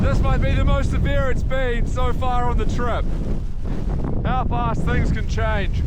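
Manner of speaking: high, projected voice over the storm. Real, not acted. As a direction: call it out over the wind and snow. Reporting outside in a blizzard.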